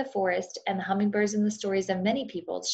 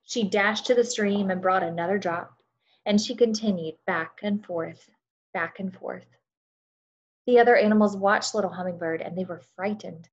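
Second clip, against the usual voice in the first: storytelling narration. A narrator's reading. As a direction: tell it like a bedtime story to a class. Reads story aloud to children.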